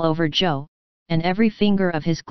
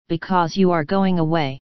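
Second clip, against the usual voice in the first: ordinary delivery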